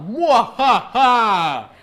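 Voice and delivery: slow drawn-out evil laugh; villain cackle. Acted. Pumpkin host's evil laugh.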